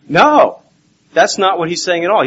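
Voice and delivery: loud, high, abrupt "No"; emphatic rejection. Real, not acted. Preacher rejecting others' reading of Paul, emphatic.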